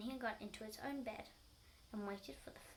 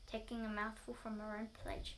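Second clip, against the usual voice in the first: usual speaking voice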